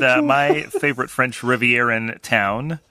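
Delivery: usual speaking voice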